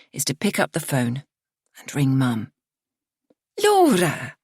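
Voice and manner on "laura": high, bright call of her name; delighted. A narrator's reading. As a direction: greet her daughter with joy. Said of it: "The delight in her voice".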